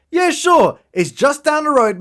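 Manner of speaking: high-pitched, casually obliging mock voice; chirpy helpful local. Pub local giving directions to the rebels, chirpy mock helpfulness.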